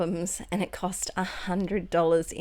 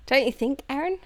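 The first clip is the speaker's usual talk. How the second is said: usual speaking voice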